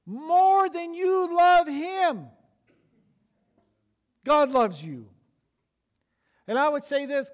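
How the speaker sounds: loud, high, drawn-out preaching; full-force preaching. Acted. Pastor preaching to the church at full force. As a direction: preach it out, ringing and slow.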